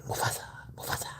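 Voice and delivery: fast, soft whisper for the cat; fast whispered voicing. Owner voices the cat saying Mufasa in a fast whisper. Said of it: "Say it".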